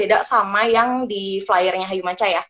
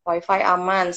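ordinary talk, nothing marked

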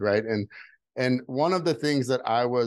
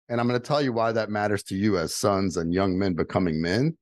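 ordinary talk

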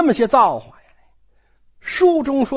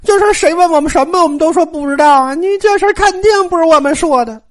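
high, loud and tearful denial; scared, tearful pleading; 7 semitones higher, 4 dB louder than usual. Acted. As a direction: plead your innocence through tears. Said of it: "吓得这一把鼻涕一把眼泪". (小丫头们向贾琏哭着喊冤，又高又响)

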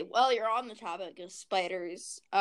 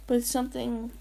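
usual speaking voice

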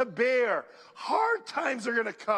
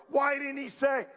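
ordinary talk, nothing marked

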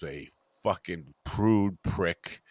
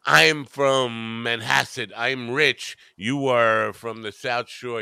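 slow, higher-pitched mock snobbery; looking down nose; 3 semitones higher, 3 dB louder, much slower than usual. Rich Manhasset man to South Shore delivery guy, looking down his nose. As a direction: stretch each word with imitated upper-class disdain. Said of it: "looking down his nose at me like this".

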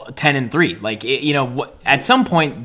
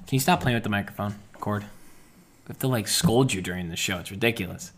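hushed scolding; 4 semitones lower, 9 dB quieter than usual. Real, not acted. Scolding cohost on air.

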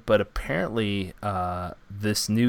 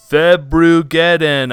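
big, slow, louder announcer boom; 5 semitones higher, 12 dB louder, slower than usual. Shown as a demonstration. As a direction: announce it like a movie trailer. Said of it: "ladies and gentlemen, we are on the verge of".

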